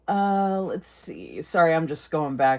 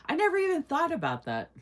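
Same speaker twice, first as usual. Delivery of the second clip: surprised realization; 11 semitones higher than usual. Real, not acted. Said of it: "oh my gosh".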